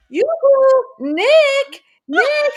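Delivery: high, loud sing-song yoo-hoo; calling out. Retold or imitated. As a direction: call him across the room teasingly. Grandma calling the tour director.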